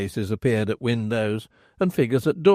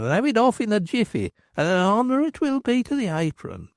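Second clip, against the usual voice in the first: higher, playful flattery; gallant landlord; 9 semitones higher than usual. Acted. Landlord flirting with the floury woman, playful. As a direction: flatter her with a twinkle.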